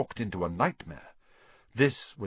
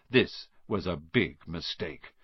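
ordinary delivery, nothing marked

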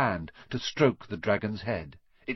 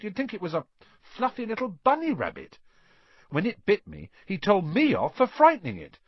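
put-on faster, higher complaining boy; indignant Ron; 9 semitones higher, faster than usual. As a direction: rattle off the grievance, fed up.

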